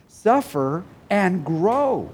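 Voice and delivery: slow emphasis. Acted.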